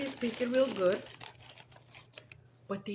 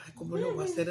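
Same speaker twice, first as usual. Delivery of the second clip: mock worried; 9 semitones higher, much faster than usual. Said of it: "And then I said".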